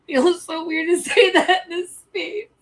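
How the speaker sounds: high, loud, emotional, broken by a laugh; overcome with emotion. Real, not acted. Streamer confiding in viewers, emotional and high-pitched. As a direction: voice lifted, fighting feelings with a laugh. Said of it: "I don't wanna be this emotional".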